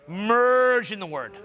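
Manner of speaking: slow stretched phrase; drawn out. Shown as a demonstration. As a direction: draw the words out slowly. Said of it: "practice speaking from that paradigm".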